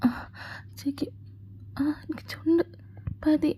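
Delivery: high, soft, breathless pleading whisper. Acted. Girlfriend pleading mid-kiss, breathless and whispering. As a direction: gasp out the words, out of breath and soft.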